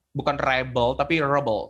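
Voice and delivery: ordinary delivery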